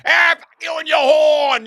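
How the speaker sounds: put-on high, loud, slow Boston-accented shout; Boston road-rage yell. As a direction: yell it like an angry Boston driver with his horn. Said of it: "Everybody's like".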